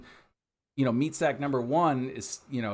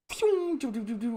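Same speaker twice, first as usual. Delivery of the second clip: very high whistled phew then doo doos; mouth-made flying object noise; whooshing sound effect; 9 semitones higher than usual. Retold or imitated. Imitating a thrown hubcap sound.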